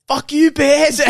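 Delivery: high, loud taunting yell; heckling shout. Retold or imitated. Rival fan breaking the silence, high and loud. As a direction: shout it across the silent stadium.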